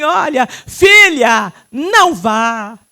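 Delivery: loud, slow call, drawn out; God's voice calling. Retold or imitated. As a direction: call her back with divine authority. God's voice speaking to her. (voz de Deus, alta e lenta)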